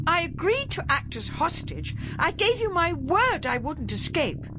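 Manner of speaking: very high, haughty bluster; indignant Duchess voice. A narrator's reading. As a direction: protest like an affronted duchess. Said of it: "putting on what Ben would have called her best Duchess voice".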